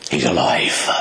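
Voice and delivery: a hushed, wondering whisper; awed whisper. Acted. Mary realizes at the tomb that Jesus is risen, whispered in awe.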